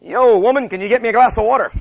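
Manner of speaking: loud, high mock-bossy husband; exaggerated rude demand. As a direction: holler it like a hammed-up demanding husband. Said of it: "That's an over-exaggeration".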